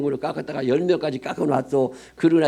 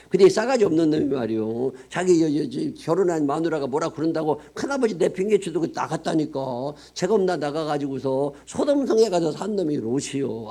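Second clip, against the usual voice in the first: preaching at full force; 4 semitones higher than usual. Acted. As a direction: preach it with mounting outrage at Lot. Preacher heating up about Lot.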